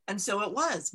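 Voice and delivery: ordinary delivery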